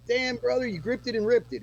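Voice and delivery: high-pitched retold praise; hyped-up friend. Friend praising a first solo show, excitedly impressed.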